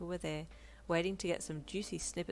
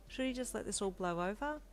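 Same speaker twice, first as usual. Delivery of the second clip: ordinary delivery, nothing marked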